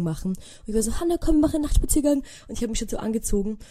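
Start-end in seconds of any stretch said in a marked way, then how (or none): 1.0-2.2 higher, fast, eager call to come along; eager urging. Retold or imitated. To sister Hannah, begging her along on a walk, fast and eager. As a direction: rush her out the door, all excited.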